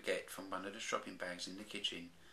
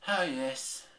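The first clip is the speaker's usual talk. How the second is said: weary sigh; 6 semitones higher, 8 dB louder than usual. Acted. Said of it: "sighed Baby Bear wearily".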